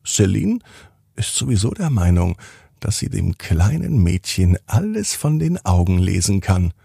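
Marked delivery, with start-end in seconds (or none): none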